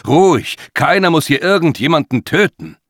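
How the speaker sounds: high, loud urgent appeal; shocked shout. A narrator's reading. Man trying to stop a killing, shocked and loud. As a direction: shout them down in alarm. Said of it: "rief James schockiert".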